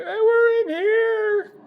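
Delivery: very high, slow squeaky little voice; idols calling out. Pretend. Preacher voicing idols stuck in a saddlebag, high squeaky call. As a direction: squeak it out like tiny idols in a bag.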